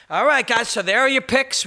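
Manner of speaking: hammed-up presenter closing the picks segment; mock TV host. Acting the host wrapping up picks.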